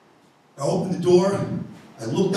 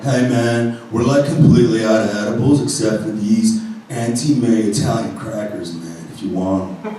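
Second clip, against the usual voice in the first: stoner voice; 9 semitones lower, 3 dB quieter than usual. Retold or imitated. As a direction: counter clerk drawling a warning. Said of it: "guy behind the counter was super stoner".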